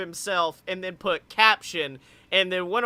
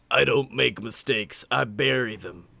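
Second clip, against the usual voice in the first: lower, quiet graveyard menace; low Undertaker voice; 8 semitones lower, 3 dB quieter than usual. Retold or imitated. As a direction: growl it low like the Deadman. Quoting Undertaker tweet.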